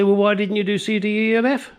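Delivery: a light parody of a fussy customer; mock complaining customer. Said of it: "and then you say".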